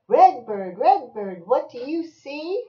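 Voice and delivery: loud singsong refrain, rising question; bouncy storybook chant; reading to a child. Acted. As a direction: punch each colour, then lift the question. Reading picture book aloud to child.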